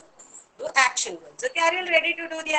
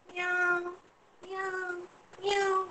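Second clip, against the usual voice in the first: soft, higher-pitched meows; cat meow; 3 semitones higher, 10 dB quieter, much slower than usual. Shown as a demonstration. Teacher showing children how a cat sounds, soft high meows. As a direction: mew softly like a small cat. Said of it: "How does a cat sound?".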